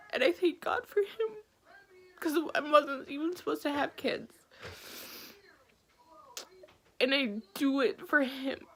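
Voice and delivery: high, breathy tearful voice, barely held together; real crying, voice cracking upward; near tears. Real, not acted. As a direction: speak through tears about your son. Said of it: "I'm trying not to cry".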